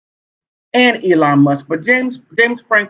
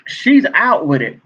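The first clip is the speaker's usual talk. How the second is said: normal talking voice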